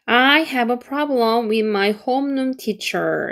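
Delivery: very slow, each word clear; slow model sentence; slow careful read. Shown as a demonstration. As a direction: read it slowly for learners. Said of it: "이렇게 하면은".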